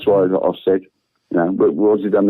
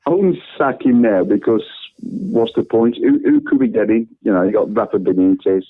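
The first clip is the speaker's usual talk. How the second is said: usual speaking voice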